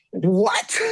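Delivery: a sharp retold yelp of shock; stunned what. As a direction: throw out the word in outrage. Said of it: "And I was like".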